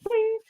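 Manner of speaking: small high ringing "doing"; soft springy boing. Retold or imitated. Angler showing what the line did, soft high boing. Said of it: "So you see it go".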